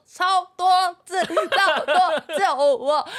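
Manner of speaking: crying on the phone about the insects outside, mock sobbing; retold sobbing panic. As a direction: replay the tearful panic with a laugh. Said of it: "然后我就是哭".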